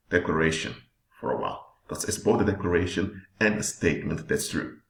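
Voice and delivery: ordinary talk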